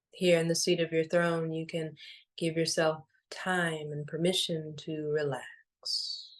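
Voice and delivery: unhurried, soothing and evenly paced; guided meditation. Acted. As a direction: guide them gently into stillness, phrase by phrase.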